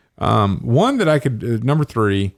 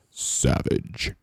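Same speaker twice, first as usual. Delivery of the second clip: deep announcer voice; 9 semitones lower, 6 dB quieter than usual. Retold or imitated. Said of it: "the deepest announcer voice".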